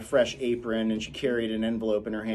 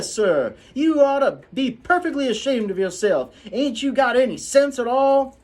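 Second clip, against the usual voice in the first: high, loud scolding housekeeper; scolding Calpurnia; 13 semitones higher, 6 dB louder than usual. Acted. Calpurnia scolding the children on the way home. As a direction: tear into the children.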